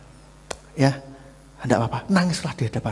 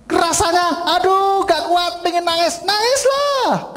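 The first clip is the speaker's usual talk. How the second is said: high, loud cry of overwhelm; acted overwhelmed outburst; 4 dB louder, much slower than usual. Preacher acting someone who can't take it, high outburst. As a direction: let the pressure burst out, pitched way up. Acts out breaking under pressure.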